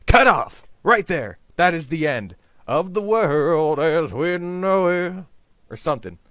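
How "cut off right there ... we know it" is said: dramatic. Acted. Dramatic doom declaration.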